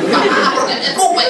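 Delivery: put-on high-pitched little-kid complaint; whining child. Child to the mother spitting while scolding, high-pitched complaint.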